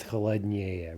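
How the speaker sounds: "холоднее" with the stress jumped to -ее; stress jumping. Shown as a demonstration. Teacher showing an exception, "холоднее" stressed on the ending. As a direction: stress the ending -нее in "холоднее", not the stem. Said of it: "an exception with the stress jumping".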